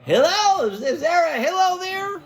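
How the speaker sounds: a rude man bawling for Hillel outside his door; yelling outside. Acted. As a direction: shout up at the house, rude and pushy. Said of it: "outside of his house yelling".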